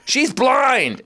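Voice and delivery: high, stretched cry; mock shout. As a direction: call it out over the chaos. Someone shouts amid the melee.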